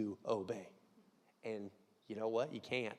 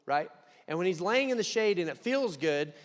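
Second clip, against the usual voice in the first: usual speaking voice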